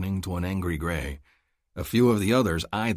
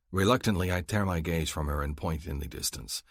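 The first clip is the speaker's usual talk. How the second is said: usual speaking voice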